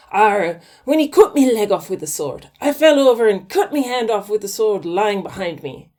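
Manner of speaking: loud, gruff mock pirate; hammed-up pirate telling his tale. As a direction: growl it like a storybook pirate. Pirate voice in told joke.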